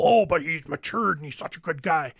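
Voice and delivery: low, soft fond dad voice; lightly voiced dad. The dad praising the handyman, low and soft. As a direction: murmur it like a proud old dad. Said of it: "he's like".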